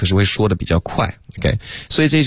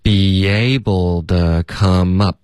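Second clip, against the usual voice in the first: slow, evenly stretched linked model for learners; slow practice; much slower than usual. Shown as a demonstration. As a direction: model it slowly, sounds run together. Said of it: "我们就第二段". (慢速连读示范)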